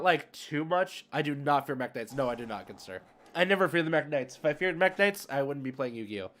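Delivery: usual speaking voice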